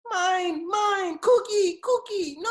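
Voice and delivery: put-on high, loud whining child, each word wailed; whiny toddler. Dad imitating his crying son, high toddler whine. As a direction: wail like a crying toddler wanting a cookie. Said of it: "he cries every day".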